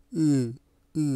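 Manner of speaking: ই muffled by a tongue kept at the bottom of the mouth; failed vowel ই. Shown as a demonstration. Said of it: "ই উচ্চারণ হচ্ছে না". (জিহ্বা নিচে রেখে বিকৃত ই)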